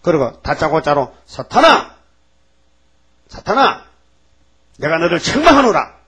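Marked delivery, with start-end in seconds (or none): 1.2-3.8 high, loud thundering call; rebuking shout. Acted. God rebuking Satan, shouting him down. 4.8-5.9 louder, weighty proclamation falling at the end; stern rebuke. Acted. God rebuking Satan, a loud stern proclamation.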